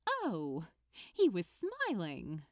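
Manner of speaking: usual speaking voice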